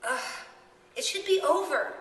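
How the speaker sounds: rising, slow frustrated outburst; angry frustration. A narrator's reading. Coraline to the children, frustrated and angry. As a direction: let the frustration boil over. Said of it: "said Coraline in her dream angrily".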